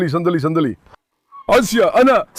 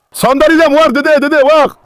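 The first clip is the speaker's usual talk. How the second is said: raised, higher-pitched urgent warning; 5 semitones higher, 3 dB louder than usual. Real, not acted. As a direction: call out urgently to stop the dogs. Pinipigilan ang mga aso. (pasigaw na pagpigil)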